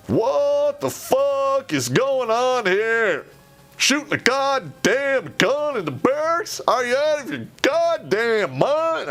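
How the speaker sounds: high, slow, outraged sergeant voice; outraged chewing-out. Retold or imitated. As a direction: drag out each word like a furious first sergeant. Sergeant chewing out rangers in barracks.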